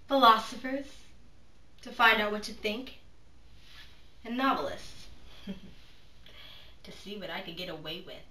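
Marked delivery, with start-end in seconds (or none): 5.1-6.8 quiet knowing chuckle under breath; a hushed, amused exhale of a laugh; soft breathy laugh. Acted. Laughs between her cynical lessons.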